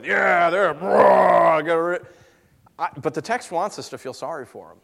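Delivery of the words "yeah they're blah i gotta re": dismissive mock reader. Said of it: "I try to go that way".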